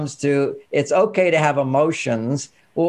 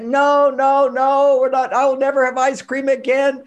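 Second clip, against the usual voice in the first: put-on protesting child; 8 semitones higher than usual. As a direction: wail never like a stubborn kid. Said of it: "she goes right into it".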